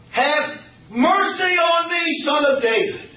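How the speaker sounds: slow, high shout for mercy; desperate cry. Acted. Blind man shouting over the crowd to Jesus, slow and high. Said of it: "he was crying out all the more".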